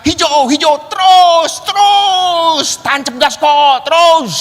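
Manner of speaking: high, loud yelling to keep going; shouting go. Retold or imitated. Friend on the back urging through the red light, shouting. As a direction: bark at the driver to run the light. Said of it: "Dia yang lihat lampu merah".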